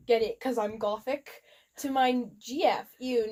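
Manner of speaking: ordinary delivery, nothing marked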